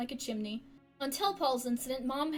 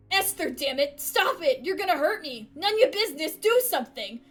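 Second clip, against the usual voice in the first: louder, higher frantic plea; panicked father; 4 semitones higher, 8 dB louder than usual. Acted. Father at knifepoint calling for help, panicked shout.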